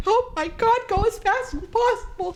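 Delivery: high, squeaky flustered voice; mock panic. Flustered traveler reacting to the TSA guy, mock panic. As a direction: panic in a tiny high-pitched voice.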